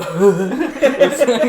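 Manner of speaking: a high, loud yelp turning into a laugh; audible pained yelp-laugh. Retold or imitated. Patient reacting aloud to the doctor's tap, a high yelp-laugh. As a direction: let the pain escape as a laugh. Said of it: "I audibly went".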